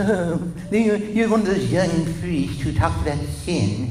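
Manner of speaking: lightly voiced scolding old nun; a hint of a disapproving elderly sister; mild mock scold of a young priest. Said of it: "She said".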